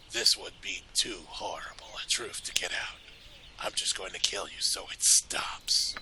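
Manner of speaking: hushed, sinister rasping threat; Dorn. Acted. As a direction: whisper it like a brooding killer. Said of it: "can you read it in Dorn's voice?".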